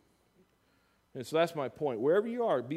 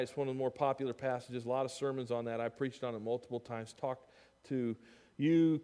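ordinary delivery

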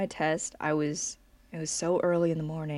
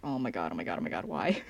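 much lower, quiet, scared repeated oh my God; whisper-mumble it in dread; low frightened mumbling to herself; panicked under the breath; 10 semitones lower, 4 dB quieter, faster than usual. Retold or imitated. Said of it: "I was like".

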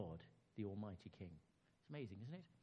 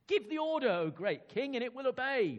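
much higher, louder pleading flattery; eager courtier; 11 semitones higher, 11 dB louder than usual. Acted. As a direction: urge the king on loudly. Courtier urging the king.

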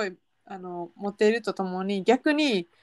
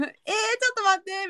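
mock surprise; 9 semitones higher, much faster than usual. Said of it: "みたいな言いたくなったけど".

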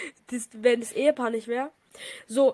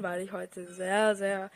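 sick voice; 5 semitones lower, 7 dB quieter, much slower than usual. Pretend. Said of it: "Man hört schon an meinem Voice Crack".

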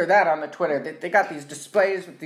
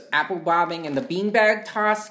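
ordinary talk

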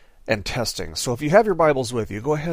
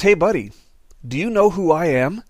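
a hint of a swaggering devil, higher and louder; Satan; 4 semitones higher, 4 dB louder than usual. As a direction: jeer like a cocky devil. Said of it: "Satan walks up ... and he says".

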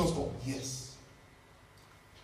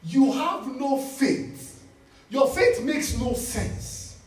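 slow emphasis; 2 semitones higher, much slower than usual. Real, not acted. Pastor driving home a point.

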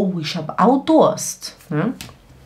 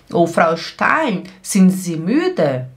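ordinary talk, nothing marked

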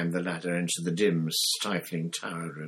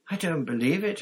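high, louder dismayed wail; 10 semitones higher, 4 dB louder than usual. Acted. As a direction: wail it in despair. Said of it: "Hermione wailed".

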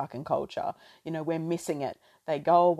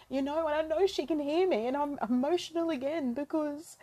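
holding back real tears while speaking; tearful; 7 semitones higher than usual. Real, not acted. Podcaster honoring her late nana, tearful and breaking. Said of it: "I did not plan on crying".

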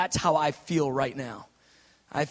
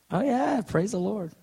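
ironic praise; 6 semitones higher, slower than usual. Retold or imitated. Pastor after a two thousand dollar spill, ironic praise. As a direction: praise through the mishap, tongue in cheek.

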